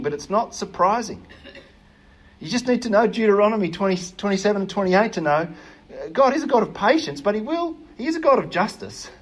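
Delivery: ordinary talk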